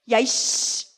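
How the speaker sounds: a pretend Jesus grilling Peter, loud and mocking; mock furious interrogation. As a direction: corner him and demand an answer. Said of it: "예수님이 따져도 백 번을 따질 수 있었어요".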